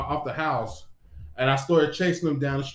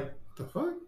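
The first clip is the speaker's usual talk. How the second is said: baffled mutter; 12 semitones higher, 12 dB quieter than usual. Retold or imitated. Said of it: "I'm like".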